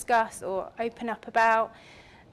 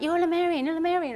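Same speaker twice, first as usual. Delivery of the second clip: high, loud, insistent mock chant; mock excited chorus; 7 semitones higher, 4 dB louder, faster than usual. Everyone telling her she's Lemurian, excited mock chant. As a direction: repeat it like a crowd that's sure of it. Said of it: "everyone's like".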